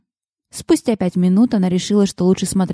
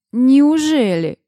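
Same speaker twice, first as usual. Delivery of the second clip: drawn-out, higher sarcastic question; more sarcastic; 7 semitones higher, 3 dB louder, much slower than usual. A narrator's reading. Man repeats his question to her, heavily sarcastic. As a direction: stretch it slowly, pitched up in mock disbelief. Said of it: "повторил он вопрос ещё более саркастическим тоном".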